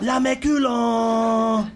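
put-on chanting Spanish in a French accent. Teasing a bumlick in Spanish, drawn-out French accent. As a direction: draw it out high and loud in mock French. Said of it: "doing it in a French accent".

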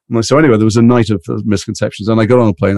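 ordinary talk, nothing marked

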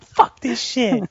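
high, slow, drawn-out rage; mock tantrum. Eight-year-old flipping the board, slow high mock rage. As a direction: stretch out the kid's fury. Tossing the game as a kid.

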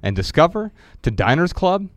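measured book reading voice; reading from his book. Acted. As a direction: read the passage aloud, deliberately. Reads his book aloud.

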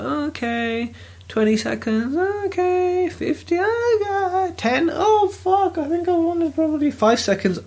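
high, soft, strained countdown nerves; mock rising panic. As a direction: squeak through mounting auction jitters, hushed. Acting out nerves as auction clock runs down.

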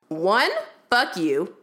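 ordinary talk, nothing marked

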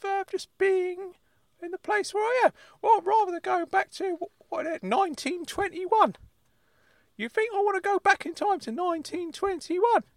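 high-pitched indignant uncle character; squeaky-high, affronted comic voice; Uncle Claude. Acted. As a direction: play a worked-up uncle way up high.

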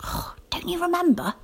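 soft breathy whisper, a little reproachful. A narrator's reading. Rosie whispering a reminder to Nicobobanus. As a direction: keep it secret, just air. Children sneaking off at dawn.

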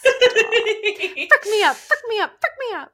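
very high, loud giddy chant; laughing squeal. Real, not acted. Co-host cracking up at the singalong, giddy shrieking. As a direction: shriek it through real laughter.

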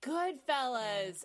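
slow, drawn-out disbelieving echo; surprised realization. Real, not acted. Friend realizes the guessed movie is Goodfellas. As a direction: stretch the title out in shock.

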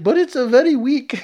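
high, loud mock Italian accent; exaggerated Italian accent on the edge of laughter. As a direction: complain in a squeaky Italian accent. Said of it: "He was, like, Italian".

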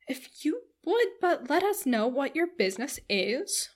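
high, slow, wheedling matron; prying matron. A narrator's reading. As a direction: coax out the secret in a nosy, lilting voice. Voices prying Mrs Jennings.

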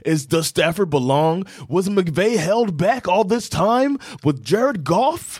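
high, drawn-out mock pundit questions; hammed-up high-pitched commentator; hyped media storylines. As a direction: pose each question like overblown sports hype. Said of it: "all of the storylines".

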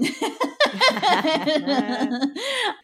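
real high-pitched burst of laughter; loud laugh. Real, not acted. Laughs at own smug remark.

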